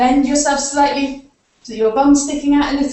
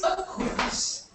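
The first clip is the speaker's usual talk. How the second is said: whisper; 7 semitones higher, 11 dB quieter than usual. Acted. Revealing the next animal.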